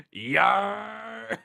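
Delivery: high, long-held slang greeting; Houston phone greeting. Shown as a demonstration. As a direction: answer the phone with one drawn-out rising word. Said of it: "when you answer the next phone call, you, you say".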